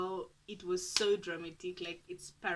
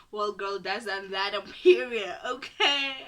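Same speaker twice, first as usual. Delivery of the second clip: sassy brag; 11 dB louder, slower than usual. Retold or imitated. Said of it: "I'm like".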